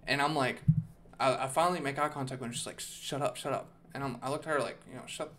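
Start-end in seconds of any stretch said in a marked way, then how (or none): 2.8-3.7 hushed shut up. Retold or imitated. Scared woman hushes him. 5.1-5.3 whispered hush. Retold or imitated. He hushes her back.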